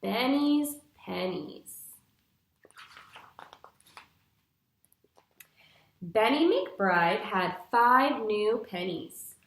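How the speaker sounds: bright, clear storybook reading for a class; patient storytime narration for young listeners; reading to children. Acted. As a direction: read it like a picture book to little kids. Teacher reads picture book aloud.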